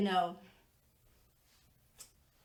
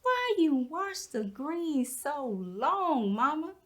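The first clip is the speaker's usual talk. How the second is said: put-on higher, drawn-out child's question; imitated wondering children; 4 semitones higher, slower than usual. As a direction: mimic the kids' slow, sing-song puzzlement. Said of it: "They'd wonder".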